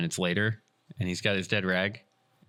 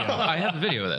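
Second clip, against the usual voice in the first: laughing; 9 semitones higher than usual. Real, not acted. Teller laughs along with the listeners.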